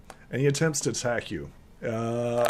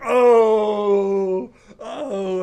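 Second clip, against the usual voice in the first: loud, high, drawn-out "ohhh"; imitated crowd "oh"; 9 semitones higher, 9 dB louder than usual. Whole crowd reacting to a gut blow, mock howl. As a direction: howl like onlookers at a big hit.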